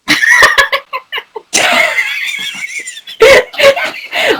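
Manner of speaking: long high-pitched laugh; helpless high laughter; real laughing fit. Real, not acted. Laughing at the unpronounceable name.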